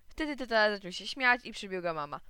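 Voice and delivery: ordinary delivery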